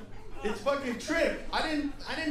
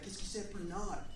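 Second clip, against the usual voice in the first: French waiter; 4 semitones lower, 9 dB quieter than usual. Retold or imitated. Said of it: "he's like".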